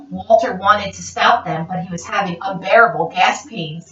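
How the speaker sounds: usual speaking voice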